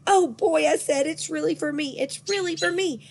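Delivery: high, thrilled squealing; excited squeal. A narrator's reading. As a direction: squeal with delight. Thrilled child zooming around.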